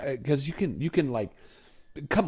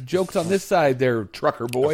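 usual speaking voice

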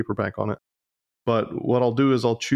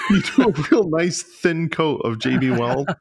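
laughing; 5 semitones higher, 3 dB louder than usual. Real, not acted. Laughing after swallowing a bug.

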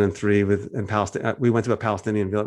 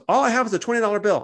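higher, hammed-up innocent Seth; mock naive Seth; 9 semitones higher, 3 dB louder than usual. Seth telling the shekel seller he only has a twenty, mock innocent and higher. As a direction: play Seth as guileless, much higher. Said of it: "And Seth goes".

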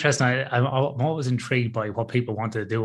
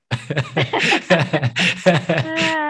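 loud wheezing laugh, higher; big laugh; 6 semitones higher, 6 dB louder than usual. Real, not acted. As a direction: burst out laughing at your own joke. Host laughs at own joke.

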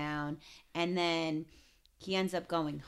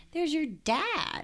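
dramatic reveal; 11 semitones higher, slower than usual. Retold or imitated.

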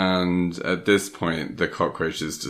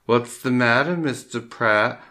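higher, slow mocking sneer; slow, higher mock-menacing taunt; taunting villain; 7 semitones higher, 4 dB louder than usual. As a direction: tease him like a cruel prankster. Taunting caller voiced in retelling.

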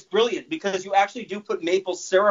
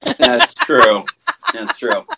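laughing; 5 semitones lower, 4 dB louder, much slower than usual. Real, not acted. Agreeing with a cohost's teasing, laughing. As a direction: laugh through the words.

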